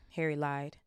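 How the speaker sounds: usual speaking voice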